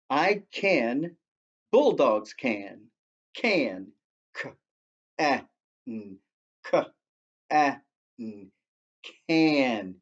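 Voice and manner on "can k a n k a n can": "Can" spelled as "K, A, N" twice, then said whole; spelling out a word. Shown as a demonstration. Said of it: "The next word is can".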